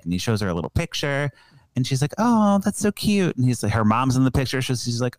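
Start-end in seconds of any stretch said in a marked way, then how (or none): 2.2-3.4 high, drawn-out gush in a retold quote. Film heroine reacting to his childhood picture, gushing. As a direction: coo it slowly, imitating a smitten woman.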